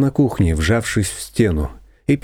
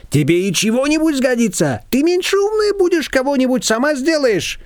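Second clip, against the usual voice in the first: high, lively neighbour voice; a bossy older neighbour pitched up high; Shushana's voice. A narrator's reading. Neighbour brushing off Nina, high and bossy.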